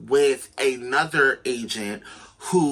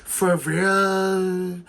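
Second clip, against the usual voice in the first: mock surprised; 3 semitones higher, much slower than usual. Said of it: "And I was like".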